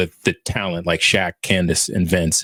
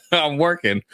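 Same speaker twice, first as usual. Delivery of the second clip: higher, chuckled retort; laughing quote; 7 semitones higher than usual. Retold or imitated. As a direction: quote him while laughing. Laughs while quoting the player's reply.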